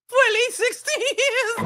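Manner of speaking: high-pitched, breaking into laughter; giggly punchline; laughing. Real, not acted. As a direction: barely get the joke out before cracking up. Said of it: "I'm not laughing about that".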